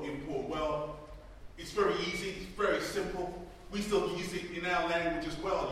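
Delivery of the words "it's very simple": ordinary talk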